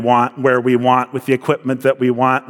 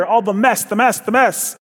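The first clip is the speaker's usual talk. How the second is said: exasperated; 8 semitones higher than usual. Acted. Exasperated repetition.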